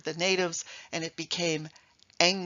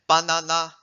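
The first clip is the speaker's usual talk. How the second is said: banana with the final syllable pushed louder; wrong stress; 4 semitones lower, 8 dB louder than usual. Shown as a demonstration. Showing the audience a wrong stress: bananA. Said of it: "are not words".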